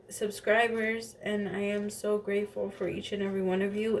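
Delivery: usual speaking voice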